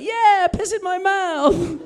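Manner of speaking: high, loud, drawn-out cartoon voice; eager toilet voice. Talking toilet to the man using it, eager cartoon voice. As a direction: whoop it slowly like a delighted cartoon creature. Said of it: "and the toilet was like".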